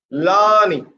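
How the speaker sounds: LEARNING with a long LAA, falling on NING; stress on first syllable. Shown as a demonstration. Teacher showing the rule for nouns, stressed LAA-ning. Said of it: "Put pressure on the first syllable".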